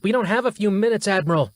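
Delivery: officer yelling at the admiral to act; yelled. Acted. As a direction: shout him down, desperate. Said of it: "yelled Yarbrough".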